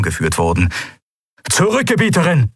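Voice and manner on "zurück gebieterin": urgent command, pitched high; befehlend. A narrator's reading. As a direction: order her back in a raised, high voice. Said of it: "befahl einer ihrer Wächter". (eindringlicher Befehl mit hoher Stimme)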